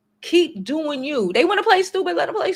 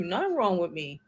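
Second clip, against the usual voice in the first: cold and dismissive; 5 semitones lower, 6 dB quieter than usual. Pretend. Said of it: "be mad cold".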